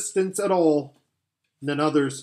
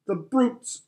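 high, tearful cry of outrage; tearful outburst; 8 semitones higher than usual. Acted. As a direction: choke it out in tears. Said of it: "tears streaming down her cheeks".

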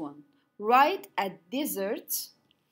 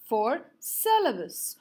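ordinary talk, nothing marked

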